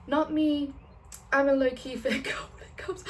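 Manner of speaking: high, put-on protest breaking into a laugh; mock-innocent denial. Fangirl jokingly denying she gets nasty, mock-innocent. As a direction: plead innocence unconvincingly, pitched right up.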